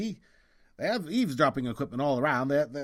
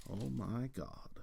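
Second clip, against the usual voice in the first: stunned whisper; 2 semitones lower, 12 dB quieter, slower than usual. Acted. Finds photo on sidewalk.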